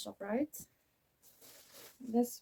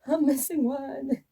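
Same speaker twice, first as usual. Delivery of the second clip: higher, louder, slow dismay; 5 semitones higher, 8 dB louder, slower than usual. Real, not acted. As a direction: drag out the dismay. Repeats in dismay.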